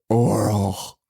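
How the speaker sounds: low, flat mock Santa; deadpan creepy Santa line. Santa answering the girl, low creepy deadpan. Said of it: "Santa just goes".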